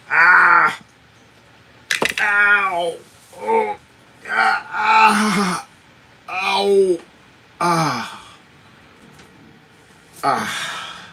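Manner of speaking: high yelps of real pain, easing off at the end; repeated short ows and ahs; pained cries. Real, not acted. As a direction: cry out at each jolt. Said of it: "this hurts".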